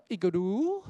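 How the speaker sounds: IGLOO loud, LOO climbing high; questioning "igloo?", wrongly lifting the ending. Retold or imitated. Teacher imitating schoolchildren, last syllable of "igloo" pulled high. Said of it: "一律拉高最后音节".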